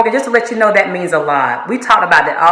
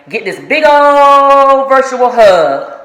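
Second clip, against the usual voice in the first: higher, slow and stretched, full of affection; drawn-out affectionate hug; 5 semitones higher, 4 dB louder, much slower than usual. Real, not acted. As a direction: wrap him in the words like a hug. Sending a farewell hug.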